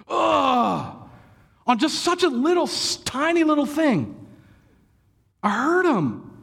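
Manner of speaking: high, anguished self-reproach; pained regret. Real, not acted. Pastor confessing he hurt his son, anguished and high. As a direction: groan over the little mistake that hurt him.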